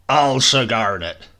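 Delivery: put-on a hearty old soldier roaring his catchphrase; Foghorn's roar. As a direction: bellow it across the parade ground. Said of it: "Foghorn used to roar".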